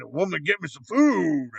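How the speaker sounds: slow, drawn-out imitation of a rude man; lightly mock a bossy order, dragging it out; jerky demand. Rock idol ordering a woman about, mock jerky demand. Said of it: "kind of a jerk to her".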